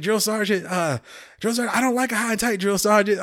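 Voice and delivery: the recruit blurting excuses to his sergeant; hammed-up flustered young soldier; nervous recruit.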